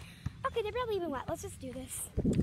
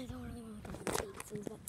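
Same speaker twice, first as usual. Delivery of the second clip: muttered aside; 7 semitones lower, 14 dB quieter, faster than usual. Real, not acted. Reluctant aside.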